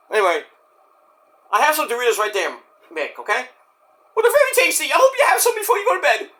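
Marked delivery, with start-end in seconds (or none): 4.2-6.3 high, fast, squeaky sales pitch. Pretend. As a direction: sell them like a cartoon mascot. Squeaky voice praises Doritos.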